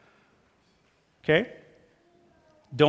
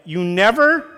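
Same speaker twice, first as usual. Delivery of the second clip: mock criticism; 4 semitones higher, 6 dB louder than usual. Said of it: "Criticism is more like".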